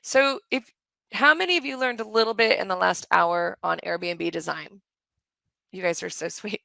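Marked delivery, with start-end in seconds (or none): none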